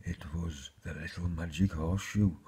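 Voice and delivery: ordinary delivery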